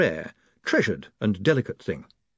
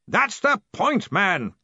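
booming; 10 semitones higher, slower than usual. A narrator's reading. Ridcully booming.

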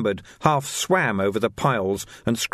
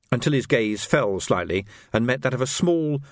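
ordinary talk

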